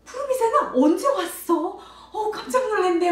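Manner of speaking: high, loud hammed-up startle with a gasp; pretend surprise. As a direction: play astonished to delight a child. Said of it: "막 이러면서 놀래주잖아요". (과장되게 깜짝 놀란 척)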